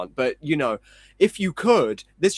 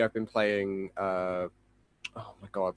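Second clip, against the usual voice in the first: usual speaking voice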